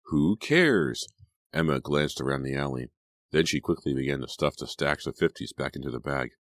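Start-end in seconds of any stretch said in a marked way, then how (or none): 0.1-1.1 higher-pitched, brushing it off; mildly exasperated dismissal; dismissive retort. A narrator's reading. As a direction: wave the worry away with a lifted voice. Friend brushes off the worry.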